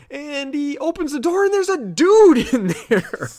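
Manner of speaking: loud, very high, laughing surprise; excited reveal. Real, not acted. Telling friend about the man behind the door, excited. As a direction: burst out with the shock reveal.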